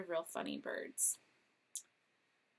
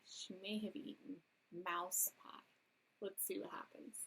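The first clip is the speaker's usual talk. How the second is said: whisper; 8 dB quieter than usual. A narrator's reading. Reader hints at secret twist.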